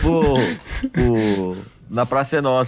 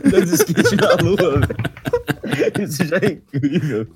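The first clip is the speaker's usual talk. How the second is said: breathless from laughing, voice pitched up; after laughing hard; 9 semitones higher than usual. Real, not acted. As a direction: speak through tears of laughter. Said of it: "Tô chorando de rir".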